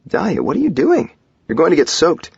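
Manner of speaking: high, loud disbelieving call; startled protest. A narrator's reading. Husband seeing her stop in the rain, startled protest. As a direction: call out in alarmed surprise.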